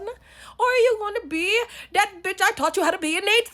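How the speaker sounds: high, loud rallying climax; shouted self pep talk. Acted. Challenging herself to be who she taught herself to be, shouting high. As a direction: build to a high yell on the last word.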